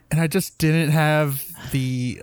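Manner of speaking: ordinary delivery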